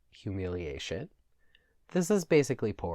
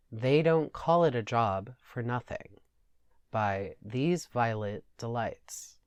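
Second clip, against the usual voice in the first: usual speaking voice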